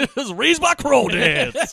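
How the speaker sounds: high, loud, slow mock Southern accent; Southern aristocrat. Mocking an actor's ridiculous accent, high hammed-up Southern drawl. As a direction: stretch out an absurd Southern accent. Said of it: "like an old money aristocrat from the South".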